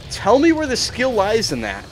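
a high, loud challenge to the screen; angry demand. Real, not acted. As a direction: demand an answer, fed up. Ranting at the game's unfairness.

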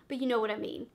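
usual speaking voice

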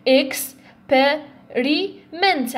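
experiment очень медленно по слогам; растяни experiment по слогам; slow syllable split. Shown as a demonstration. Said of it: "عندنا فور سيلابوز".

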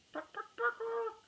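high, slow chicken clucks; clucking like a chicken. Acted. The gang mocking Brother as chicken, high slow clucking. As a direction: cluck at him like a hen. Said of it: "clucked the gang".

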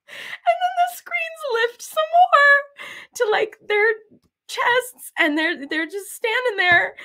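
very high, squealing through laughter; helpless laughter. Real, not acted. Describing the screens lifting, helpless high laughing. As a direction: force the words out while cracking up. Laughing too hard to talk.

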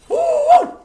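loud squealing ooh; high falsetto ooh. Real, not acted. Streamer squeals during a fight, high falsetto.

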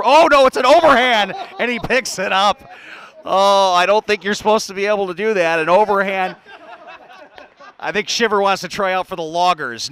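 loud, surprised call of a wild throw; excited play-by-play. Real, not acted. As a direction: burst out as the rules get broken. Overhand cabbage throw shocks announcer.

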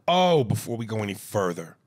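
ordinary talk

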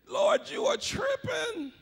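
high, drawn-out protest to God; mock disbelief. Believer balking at God's plans, high mock disbelief.

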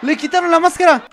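high, loud shocked yell; excited shout. Real, not acted. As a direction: shout it in disbelief. Mask pulled off in match.

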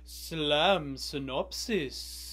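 slow, low, drawn-out title announcement; announcer voice. Acted. Host announcing the show title, slow and low.

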